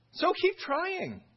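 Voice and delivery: high, slow sarcastic jab; mock them into trying harder; sarcastic taunt. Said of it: "he's saying".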